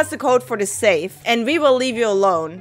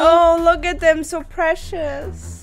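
mock cooing; 5 semitones higher, slower than usual.

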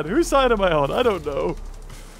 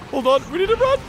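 high panicked run chant; being chased; faster than usual. Real, not acted. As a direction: flee in a panic. Fleeing fire raptor.

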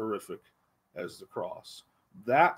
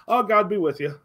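breezy, higher, louder offhand blessing; throwaway "God be with you" said in passing; casual well-wishing; 8 semitones higher, 9 dB louder than usual. Retold or imitated. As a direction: toss it off like a polite goodbye. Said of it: "He's not just sending them with this".

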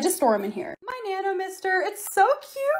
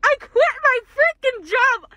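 excited repeat; 9 semitones higher, 4 dB louder, slower than usual. Real, not acted. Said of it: "repeated announcement".

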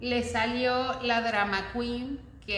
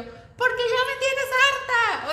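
high, loud, drawn-out reenacted scream; furious yell; 14 semitones higher, 6 dB louder, slower than usual. Fed-up woman screaming back at her partner, reenacted furious yell. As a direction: yell it at full pitch, acting out the fight. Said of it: "yo así gritando".

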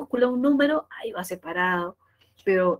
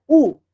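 "U" punched out loud; stressed U; 5 semitones higher, 12 dB louder than usual. Shown as a demonstration. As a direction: hit the vowel "U" with full vocal force. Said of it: "Esa es la sílaba tónica con mayor fuerza de voz".

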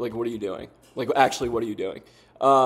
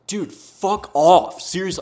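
exasperated; 6 semitones higher, 4 dB louder, slower than usual. Real, not acted. Frustrated at opponent's question marks.

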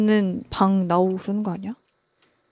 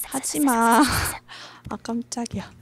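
ordinary delivery

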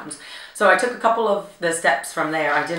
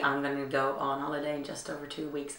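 normal talking voice

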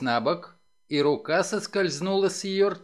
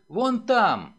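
higher excited call; agitated; 4 semitones higher than usual. A narrator's reading. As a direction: point it out urgently, pitched up. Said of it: "взволнованно пояснила какая-то женщина".

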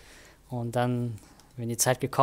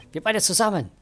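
put-on higher, louder eager young heroine; girl voice; 8 semitones higher, 3 dB louder than usual. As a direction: bluff with big cheerful confidence. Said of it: "ich muss ja jetzt ihre Stimme ja geben".

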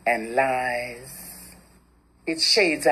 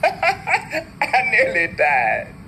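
slow, higher, shaken with laughter; laughing through words; 7 semitones higher, much slower than usual. Acted. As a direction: let the laugh nearly swallow the line. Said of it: "I laugh so hard".

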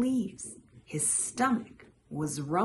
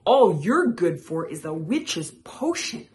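loud storybook comeback; mild shout; 8 dB louder than usual. A narrator's reading. Frog trading insults with Toad, a mild shout. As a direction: shout back without going all out. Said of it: "Frog shouted back".

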